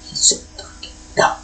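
soft breathy whisper; a fond whispered insult about the cat; whispered aside. Real, not acted. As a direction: whisper it like he might overhear.

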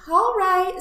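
high, loud piglet whine; exaggerated reluctant piglet; sighing resignation. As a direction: give in with a big sigh. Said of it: "sighed the piglet".

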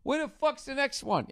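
high-pitched mock outrage of binge-watchers; impatient fans. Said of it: "And then be like".

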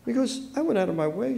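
soft, high, hurt voice; tearing up. A narrator's reading. Hurt wife to her husband, tearing up. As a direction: say it small, close to tears. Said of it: "tearing up".

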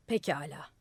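very serious. A narrator's reading. Madman granting the old man's request, gravely serious. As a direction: agree in a low, serious voice. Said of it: "Deli gayet ciddi".

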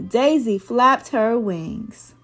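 usual speaking voice

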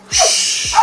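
sharp unvoiced shushing hiss; long shush. Real, not acted. Trainer quieting Tyson, long shush. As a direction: hush the dog with a drawn-out shh. Hushing the dog.

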